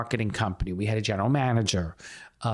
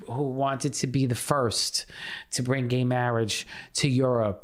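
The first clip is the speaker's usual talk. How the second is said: ordinary talk, nothing marked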